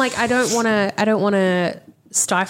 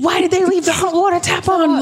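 annoyed; 10 semitones higher than usual. Retold or imitated. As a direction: complain shrilly at the tap. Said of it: "I'm like".

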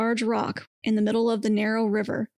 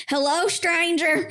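yelling; 6 semitones higher, slower than usual. A narrator's reading. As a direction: yell it out to a stranger. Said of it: "she yelled".

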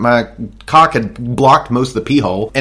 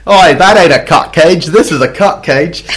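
Crocodile Dundee style Australian boast, louder; mock Australian; 2 semitones higher, 4 dB louder than usual. Aussie bloke one-ups the cage in mock Australian swagger. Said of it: "that's Australian right there".

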